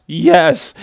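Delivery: a high ringing yes; emphatic yes. Real, not acted. Preacher to congregation, a high emphatic yes. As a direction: cry out the answer yes.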